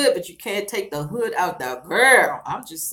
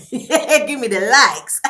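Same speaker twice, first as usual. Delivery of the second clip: laughing plea; 4 semitones higher, 7 dB louder, much slower than usual. Real, not acted. Laughing while begging for likes.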